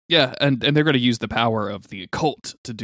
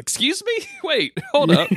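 laughing mock outrage. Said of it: "It's like".

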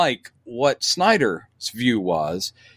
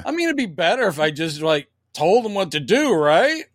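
higher, petulant son's voice; whiny grown son; 6 semitones higher than usual. Retold or imitated. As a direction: plead like a sulky adult child.

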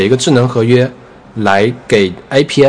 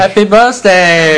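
higher, louder, slow Happy Birthday; cheerful birthday wishes; 8 semitones higher, 5 dB louder, much slower than usual. Real, not acted. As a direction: sing out Happy Birthday slowly. Echoes the birthday wish.

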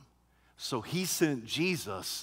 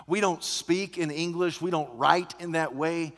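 normal talking voice

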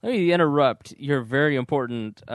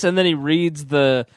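ordinary talk

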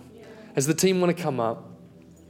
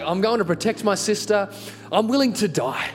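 higher, self-mocking tough-guy bravado; mock-heroic resolve; 6 semitones higher than usual. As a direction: declare it like an action hero, tongue in cheek. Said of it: "I'm like Terminator".

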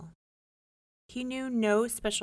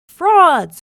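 enraged king yelling; furious shout; 10 semitones higher, 21 dB louder than usual. A narrator's reading. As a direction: roar the word in fury. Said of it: "cried the king".

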